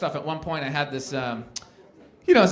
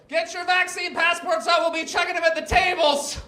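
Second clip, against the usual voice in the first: waitress; 11 semitones higher than usual. Retold or imitated. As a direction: call it out across the room like staff. Waitress announcing checks.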